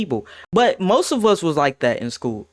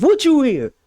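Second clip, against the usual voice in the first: louder and higher, instantly defensive; defensive snap; 9 semitones higher, 4 dB louder than usual. Retold or imitated. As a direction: fire it back on the defensive. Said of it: "why I get so defensive".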